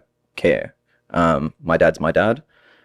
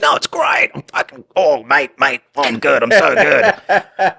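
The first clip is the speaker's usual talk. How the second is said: high, hammed-up Aussie mate voice; gleefully overdone Australian accent breaking into a laugh; put-on Australian accent; 5 semitones higher, slower than usual. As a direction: lay the Australian accent on thick, pitched up. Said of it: "I kept doing that to my friends".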